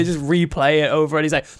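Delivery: normal talking voice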